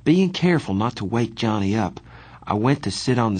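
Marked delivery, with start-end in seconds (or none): none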